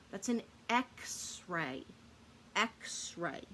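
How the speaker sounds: "X-ray" drawn out slowly, each part clear; slow modeling. Shown as a demonstration. Teacher slowly models "X-ray" for the children. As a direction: spell out the word in sound, slowly. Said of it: "X-ray begins with the letter X".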